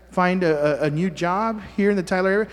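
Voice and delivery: usual speaking voice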